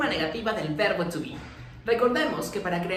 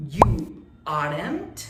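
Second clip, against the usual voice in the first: slow careful model; 3 semitones lower, 4 dB louder, much slower than usual. Shown as a demonstration. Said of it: "For example".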